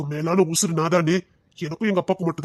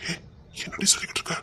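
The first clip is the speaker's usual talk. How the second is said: unvoiced, much softer; hushed disbelief; 10 dB quieter than usual. Man hushed by his lover's question, whispered disbelief. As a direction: whisper it, shaken.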